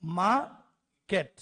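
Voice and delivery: "Market" broken as मा, pause, केट; two-beat "market" with a clear gap between syllables; split into two syllables. Shown as a demonstration. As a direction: say "market" slowly in two separate parts. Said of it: "उसके दो part है".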